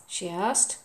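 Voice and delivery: ordinary delivery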